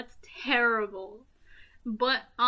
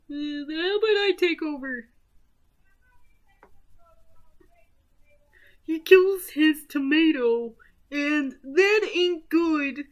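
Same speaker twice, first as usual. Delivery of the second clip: higher, louder put-on narrator voice; Mister Fist; 5 semitones higher, 5 dB louder than usual. Fist character takes over the movie recap, goofy voice.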